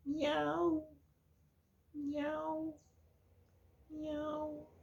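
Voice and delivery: tiny, very high, quiet meow; soft kitten. Shown as a demonstration. Teacher showing children a soft sound, gentle high kitten meowing. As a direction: mew softly like a little cat. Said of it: "que é o contrário, suave".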